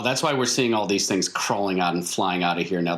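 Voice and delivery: normal talking voice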